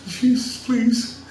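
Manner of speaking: high, whiny begging to Jesus; a high mocking voice, pleading like a needy churchgoer; mock pleading prayer. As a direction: beg God for bill money in a high put-on voice. Mock prayer quoted to listeners.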